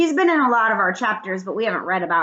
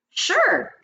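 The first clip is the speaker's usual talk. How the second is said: high, bright-pitched yes; eager agreement; 12 semitones higher than usual. A narrator's reading. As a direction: agree readily, much higher in pitch. Girl gladly agrees to help.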